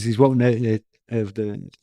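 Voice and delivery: usual speaking voice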